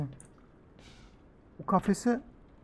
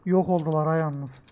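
ordinary talk

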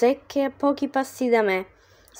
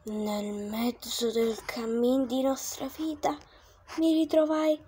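slow, soft verse reading; poem recitation; 10 dB quieter than usual. A narrator's reading. Narrator opening the tale, solemn poem reading.

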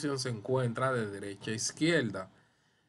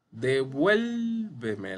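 Devuélveme with "vuél" raised and greatly drawn out; stress on VUÉL; 3 dB louder, much slower than usual. Shown as a demonstration. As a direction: say Devuélveme as de-VUÉÉÉL-ve-me. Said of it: "la el, se le marca la tilde".

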